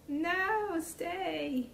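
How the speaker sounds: high, drawn-out plea; high sing-song coaxing; high-pitched pleading. Real, not acted. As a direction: beg it in a high little voice to hold still. Pleading with collapsing gingerbread walls.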